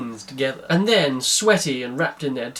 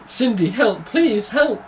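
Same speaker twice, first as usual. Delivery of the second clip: higher-pitched tearful plea; mock crying; 7 semitones higher, 4 dB louder, slower than usual. A narrator's reading. His Excellency phoning Cindy for help, acted crying. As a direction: plead as if crying, pitch climbing. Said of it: "and he was crying".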